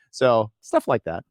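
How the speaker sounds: usual speaking voice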